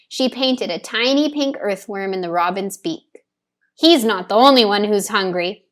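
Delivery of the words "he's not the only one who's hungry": ordinary delivery, nothing marked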